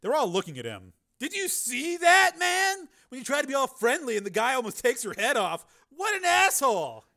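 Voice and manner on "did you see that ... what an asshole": higher man's voice, aggrieved; indignant patron. Acted. Patron complaining to the bar, indignant. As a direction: whine to the crowd.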